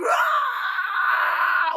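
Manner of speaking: high, loud imitated pain scream; mock scream. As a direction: shriek like she's sawing her leg. Woman cutting her leg screams.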